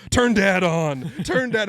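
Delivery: high, stretched-out mock tantrum cry; whiny, insistent yell like a toddler's; imitated toddler yell. Father replaying his toddler's demand to the hosts, mock yelling. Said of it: "I'm getting yelled at by my nearly two-year-old".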